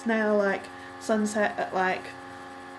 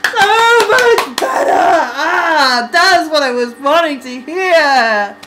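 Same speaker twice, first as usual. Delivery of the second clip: emotional excited; 6 semitones higher, 12 dB louder, much slower than usual. Real, not acted. Reacting emotionally to music video.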